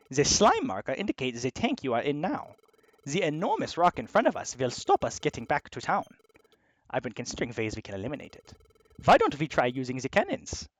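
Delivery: put-on German-accented character giving a briefing. German crew member explaining how to clear the rock, German accent. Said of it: "the only German Platypunk".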